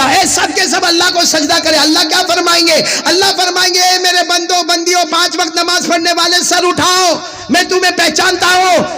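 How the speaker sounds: high, urgent sermon cry; impassioned pulpit voice; preaching God's call. Acted. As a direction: call out God's command to the crowd.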